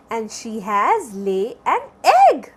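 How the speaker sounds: ordinary delivery, nothing marked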